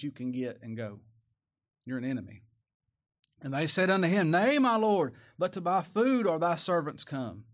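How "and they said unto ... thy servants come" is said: loud, high preached scripture; full-voiced pulpit reading; preaching at full force. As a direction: proclaim it to the back pews.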